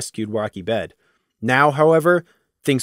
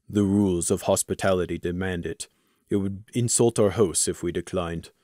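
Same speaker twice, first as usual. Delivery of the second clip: lower, softer dutiful warrior; formal warrior; 5 semitones lower, 6 dB quieter than usual. Acted. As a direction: recite the rule dutifully. Crimson's formal recited reply.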